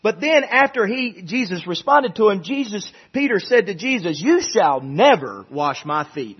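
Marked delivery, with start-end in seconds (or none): 4.2-6.4 slow, low, emphatic refusal; weighty declaration, drawn out on "never"; bold refusal. Acted. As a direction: act a defiant disciple putting his foot down. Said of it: "that's a bold statement".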